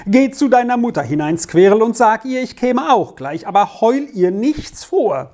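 high, exasperated sending-away; agitated order. A narrator's reading. Father sending crying daughter to her mother, agitated. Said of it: "rief Castella in immer größerer Aufregung aus".

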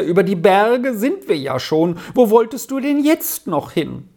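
upset mother's protest; weeping. A narrator's reading. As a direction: plead with him, voice climbing. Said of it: "fragte die weinende Mutter".

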